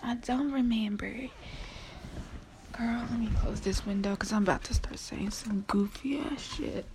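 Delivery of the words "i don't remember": whispering. Real, not acted. Losing voice, whispering to self.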